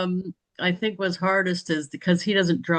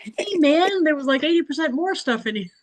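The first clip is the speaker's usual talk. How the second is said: high-pitched, comic retort in self-defense; squeaky-high mock indignation; mock protest; 11 semitones higher than usual. Retells own protest at unimpressed visitors.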